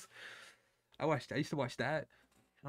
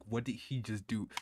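ordinary talk

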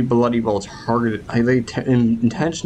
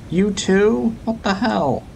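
much higher, outraged surprise; can't believe it, pitched way up; indignant disbelief; 11 semitones higher, slower than usual. Real, not acted. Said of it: "I'm just getting a bit annoyed".

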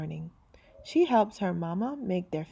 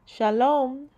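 neighbour's greeting; 5 semitones higher, 5 dB louder than usual. Acted. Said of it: "says Missus Rosen".

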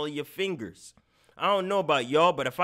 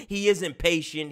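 ordinary talk, nothing marked

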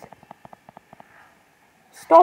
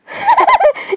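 very high squealing laugh; high giggle. Real, not acted. Streamer tickled by a cute plush, squealing giggle. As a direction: burst into a high-pitched giggle.

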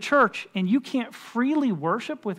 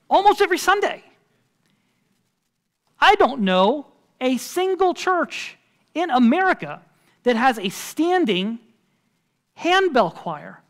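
raised pitch and volume, landing on each phrase; emphatic disbelief; 5 semitones higher, 5 dB louder than usual. Real, not acted. Preacher on the caller's rare request, emphatic disbelief. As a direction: marvel at it with pauses between phrases.

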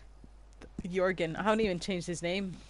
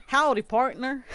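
put-on higher, louder cowboy southern accent; 5 semitones higher, 7 dB louder than usual. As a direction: give your best playful Southern howdy. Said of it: "like, a southern accent?".

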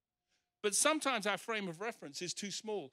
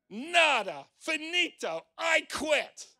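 loud, high, punched-out words; emphatic quitting; 11 semitones higher, 12 dB louder, slower than usual. Retold or imitated. Preacher voicing Jeremiah quitting on God, loud and emphatic. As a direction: shout each word as a final refusal. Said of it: "That's what Jeremiah said to God".

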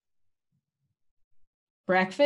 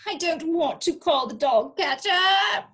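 higher, louder pleading cry; 8 semitones higher, 5 dB louder than usual. Acted. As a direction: beg not to call the catcher. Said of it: "Miss Tweedy cried".

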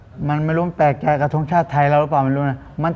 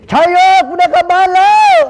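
full joyful shout; 13 semitones higher, 8 dB louder than usual. Shown as a demonstration. Host asks him to shout it into the camera, and he yells with full joy. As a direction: belt it out at the camera like you're finally going home. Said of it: "ลองตะโกนใส่กล้องดังๆสักทีดิ".

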